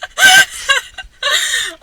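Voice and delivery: bursting into high laughter; cracking up after the retake; high loud laugh. Real, not acted.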